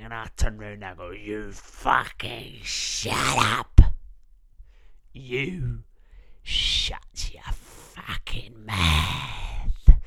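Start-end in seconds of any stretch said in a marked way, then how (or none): 1.1-10.0 slow, loud, menacing comeback; slow mock snarl. Telling the hecklers to shut up, slow loud snarl. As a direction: spit it back slowly at them.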